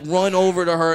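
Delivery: normal talking voice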